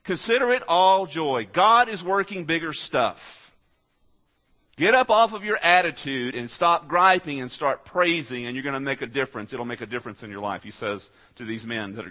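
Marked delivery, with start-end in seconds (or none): 0.0-8.5 loud, high preacher's exhortation; rally the congregation, full voice; fiery sermon shout; preaching. Acted.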